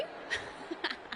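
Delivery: unvoiced-sounding, quiet cackle; mocking laugh. Host sneering at the rival team's name, mocking laugh. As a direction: snicker at your own jibe.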